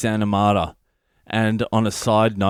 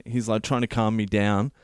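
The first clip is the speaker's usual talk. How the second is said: usual speaking voice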